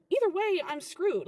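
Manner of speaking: high, loud burst, then easing; exasperated. Acted. Worker caught between two managers, exasperated. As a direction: burst out in frustration.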